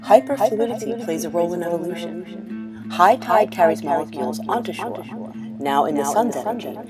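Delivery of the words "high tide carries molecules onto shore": usual speaking voice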